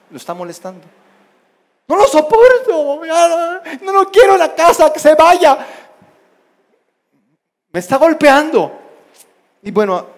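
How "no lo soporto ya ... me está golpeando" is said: high, loud, hammed-up tantrum; yelling child. Child complains to mother about sibling, tantrum yelling. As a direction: wail the complaint as if badly wronged. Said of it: "se andaban gritando, peleando".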